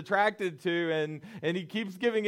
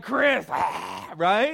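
a mock call from Mom Cherie, different timbre; 2 semitones lower, much slower than usual. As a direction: call his name like an imitated mom. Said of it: "Mom Cherie comes out and she's like".

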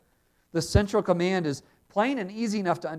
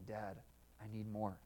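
put-on much softer, pleading like a little child; child's soft plea; 5 semitones lower, 18 dB quieter than usual. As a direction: gently ask Dad as a small child would. Child at father's hand asking.

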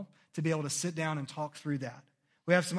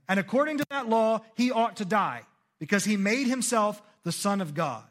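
raised, high insistent voice; the leaders pushing Pilate, loud; accusers' loud demand; 6 semitones higher, 7 dB louder than usual. A narrator's reading. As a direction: declare it like angry accusers.

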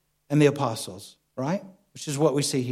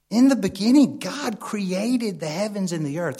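scripture quote; 3 semitones higher than usual. Acted. Preacher quotes Genesis again, hushed.

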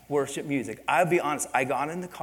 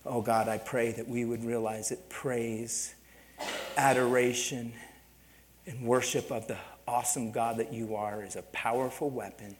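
earnest pastoral prayer voice; closing prayer; 3 semitones lower, 3 dB quieter than usual. Acted. Pastor praying for the congregation at service end, earnest prayer. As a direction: pray over the congregation.

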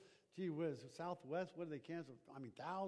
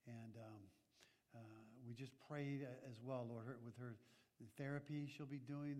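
low, soft, halting prayer voice; 6 semitones lower, 9 dB quieter than usual. Acted. Pastor praying softly for a sick member. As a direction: pray quietly for a recovering church member.

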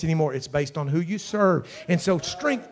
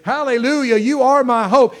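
loud, raised cry of praise; impassioned praise shout; 6 semitones higher, 10 dB louder than usual. Real, not acted. Preacher crying out to God in praise, impassioned shout. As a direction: call out your hope with full force.

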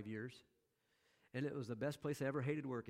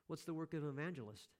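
ordinary talk

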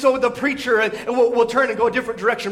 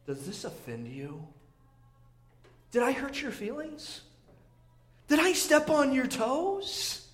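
soft challenge; 9 dB quieter than usual. Retold or imitated. Jesus answers the grumbling crowd.

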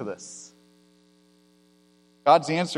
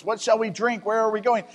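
higher, pleading complaint to Moses; complaining Israelites; 5 semitones higher than usual. Acted. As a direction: whine it up at Moses like a thirsty crowd. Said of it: "they're complaining to Moses".

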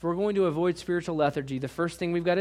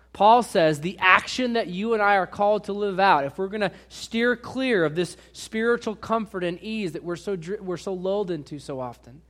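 ordinary talk, nothing marked

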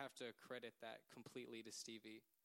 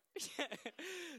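high, loud laugh through tears; teary laugh; 7 dB louder than usual. Real, not acted. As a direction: laugh while welling up.

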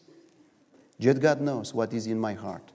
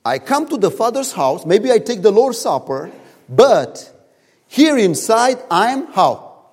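angry son; 9 semitones higher, 9 dB louder than usual. Retold or imitated.

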